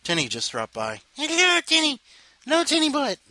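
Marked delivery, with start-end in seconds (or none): 1.2-3.2 high squeaky voice for the kitty; cutesy cat voice. Real, not acted. Greeting the small cat.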